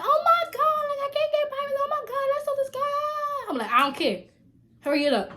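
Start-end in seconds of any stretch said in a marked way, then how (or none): 0.0-3.5 put-on very high, loud squeaky girl voice; mock the girl's shrill panic; panicked girl. Girl hiding in the stall answering her, hammed-up squeal. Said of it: "She's like". 3.7-5.3 louder, pushy command; loud order. Retold or imitated. Ordering the girl in the stall out, loud command.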